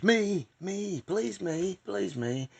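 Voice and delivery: put-on high, pleading kid begging to be chosen; a kid straining to be picked, voice up high; eager kid pleading to be called on. Johnny begs to be picked.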